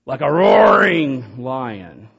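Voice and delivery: loud slow growling roar of a lion. Acted. Preacher to congregation, acting the lion with a loud slow roar. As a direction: stretch it out like a prowling lion.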